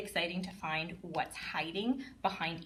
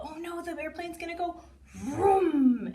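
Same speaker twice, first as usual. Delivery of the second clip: higher, louder, slow drawn-out vroom; storytime excitement; 9 semitones higher, 6 dB louder than usual. Shown as a demonstration. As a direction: ham up the airplane for a toddler. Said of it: "doing things like that".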